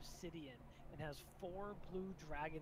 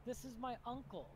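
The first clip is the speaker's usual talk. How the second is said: a higher, formal introduction; a hint of a queen, pitched up; lightly voiced queen; 8 semitones higher than usual. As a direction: present your uncle in a slightly raised royal voice. Queen introduces her uncle in game.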